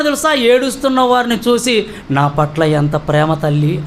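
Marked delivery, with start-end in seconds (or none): 2.1-3.8 low, slow and gentle; tender, grateful. Retold or imitated. Preacher imagines Jesus answering weeping women, low and tender. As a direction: drop far down in pitch, as if touched by their love.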